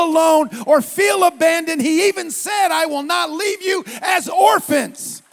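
loud, high, shouted preaching building to song; preaching at full force. Acted. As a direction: preach it out with rising fire.

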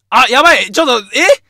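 loud, high startled fluster; real panic. Real, not acted. Radio host sees time is up, panicking. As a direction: blurt it out in sudden alarm. Realises airtime is running out.

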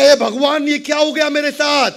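high, loud cry to God; loud, high mock lament; anguished wail. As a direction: wail at the burning house. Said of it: "जोर जोर से रोने लगा".

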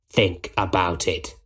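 low, quietly menacing villain; sinister Goblin. Acted. Goblin parts from Spider-Man, quietly menacing. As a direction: leave it hanging in a low villain voice.

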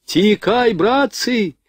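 high-pitched cry of warning; alarmed shout. A narrator's reading. Rifleman warns comrades of a mine, shouting in alarm. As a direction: cry out to warn everyone, way up high. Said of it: "вдруг закричал подбежавший стрелок".

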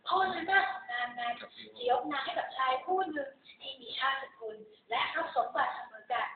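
plain reading voice

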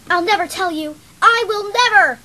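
defiant shout. Acted. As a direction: shout your refusal at the captor. Captive defies the villain.

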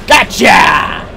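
loud victory yell; triumphant shout. Real, not acted. Kills the pursuing enemy.

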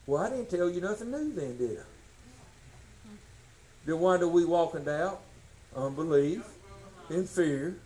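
normal talking voice

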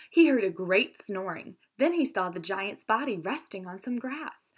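plain reading voice

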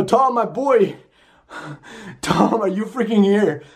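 rattled, voice up high; alarmed. Real, not acted. Repeats it, alarmed and shaken.

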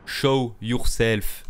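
put-on French accent. Streamer slowly ordering the ghost in French-accented English. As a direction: stretch each word out with a French accent. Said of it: "Putain, mon accent, il est pourri".

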